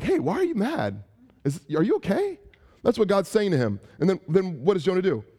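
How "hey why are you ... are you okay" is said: high, caring check-in; concerned friend. Retold or imitated. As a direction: gently ask if they're okay. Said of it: "people are like".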